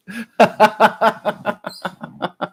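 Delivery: long, high laugh; real laughing fit. Real, not acted. Streamer cracking up at a viewer's message, long laugh. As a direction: crack up helplessly at a joke.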